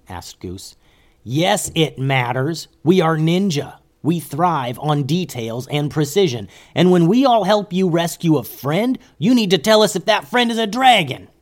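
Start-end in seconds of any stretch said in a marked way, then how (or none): 1.2-11.3 scolding that climbs higher and louder; exasperated yell. A narrator's reading. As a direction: build from firm reproach into a yell. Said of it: "yelled Momo".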